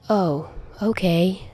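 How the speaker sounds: soft, slow disappointed sigh of a reply. A narrator's reading. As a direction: let it out on a tired sigh. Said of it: "Peter says with a sigh".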